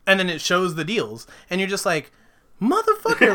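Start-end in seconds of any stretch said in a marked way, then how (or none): 2.6-3.3 high, loud burst of disbelief; acted shock, pitched way up; astonished reaction. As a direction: exclaim it as if floored by a twist.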